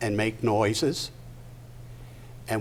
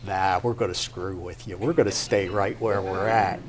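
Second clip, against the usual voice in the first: lightly voiced stubborn refusal, a bit lower; balky body part; 3 semitones lower than usual. Other body part refusing Larry's plan to move, stubborn. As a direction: refuse in a low, unbudging voice. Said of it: "other part of my body will say".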